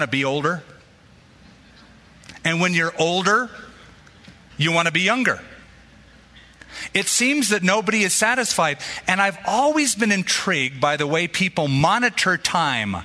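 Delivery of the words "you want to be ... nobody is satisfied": ordinary delivery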